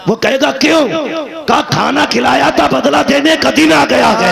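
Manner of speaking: high, loud sermon at full force; fiery preaching. Acted. Preacher rousing the congregation with fervent, loud, high preaching.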